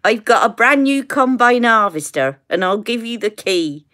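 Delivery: West Country farmer's Somerset burr; Somerset accent. Shown as a demonstration. Woman quoting a combine harvester song, Somerset accent. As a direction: put on a rural Somerset accent. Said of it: "my accent".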